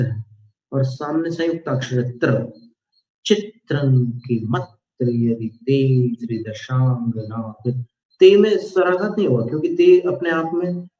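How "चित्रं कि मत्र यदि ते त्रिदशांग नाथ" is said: slow chant, short vowels in "चित्रं" and "मत्र" struck into the त्र; struck conjunct consonants. Shown as a demonstration. Teacher demonstrating swaraghat in a verse, struck conjunct consonants. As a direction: lean "चित्रं कि मत्र" hard onto each doubled त, slowly and low. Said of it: "ये जो टक्कर मारी जाती है उसको स्वराघात कहते हैं".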